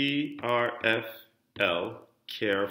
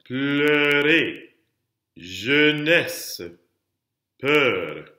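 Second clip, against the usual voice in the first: "Pleurer, jeunesse, peur" drawn out with weighty endings; diction-drill "Pleurer, jeunesse, peur" with pauses between; last-syllable emphasis; 4 semitones higher, 4 dB louder, much slower than usual. Shown as a demonstration. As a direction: model "Pleurer", "jeunesse", "peur" one at a time, pressing the final syllable. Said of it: "putting all that emphasis on the last syllable".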